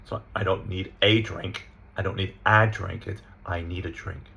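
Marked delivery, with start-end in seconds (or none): 0.3-1.7 "a" said as full letter-name AY; stressed article. Shown as a demonstration. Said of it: "But we don't stress it". 2.0-3.1 "a" said as an open AA, as in cat; stressed article. Shown as a demonstration. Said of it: "But we don't stress it". 3.4-4.2 "a" softly reduced to uh; unstressed article. Shown as a demonstration. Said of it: "But we don't stress it".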